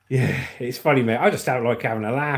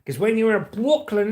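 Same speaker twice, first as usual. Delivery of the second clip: higher, emphatic Brooklyn talk; New York accent; 5 semitones higher than usual. Shown as a demonstration. Showing Lucy how New Yorkers talk, Brooklyn accent. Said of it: "this is how they talk in New York".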